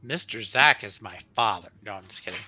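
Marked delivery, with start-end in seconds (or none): none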